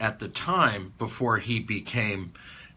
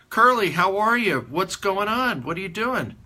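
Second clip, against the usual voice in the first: high, loud friendly hail; hearty greeting; 9 semitones higher, 4 dB louder than usual. Retold or imitated. Father spots Curly down the saloon bar, hearty greeting. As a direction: call out brightly to someone you know. Father hails an old acquaintance.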